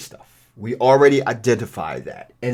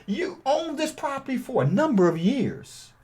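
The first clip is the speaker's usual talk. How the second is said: higher, softer seller-friendly ask; friendly caller script; 10 semitones higher, 8 dB quieter than usual. Shown as a demonstration. Buyer calling a seller, raised and gentle. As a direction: sound like a polite buyer on the phone.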